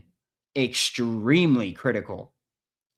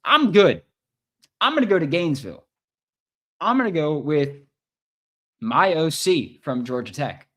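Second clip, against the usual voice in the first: excited player quote; 4 semitones higher, 5 dB louder than usual. Retold or imitated. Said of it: "and said".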